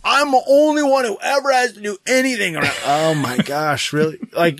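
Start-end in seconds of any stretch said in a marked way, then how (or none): 0.0-2.7 higher mock complaint of a sulky kid; whiny daughter. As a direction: play the put-upon child.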